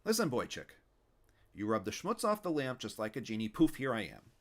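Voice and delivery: loud, cranky Yiddish grandpa; Yiddish old man. Acted. Fischel voiced as Yiddish old man.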